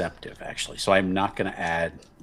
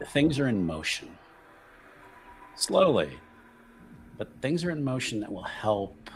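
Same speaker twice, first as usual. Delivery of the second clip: slow, deliberate insinuation with long pauses; measured schemer; 9 semitones lower, slower than usual. Acted. Buccio hints at a plan to a wary noble, slow and deliberate. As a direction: lay out a plot slowly, choosing each word.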